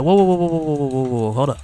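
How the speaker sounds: rapid string of whoas, tapering down; retold "hold on" chant, starting strong and easing off; halting whoas. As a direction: try to stop a fight with tumbling whoas.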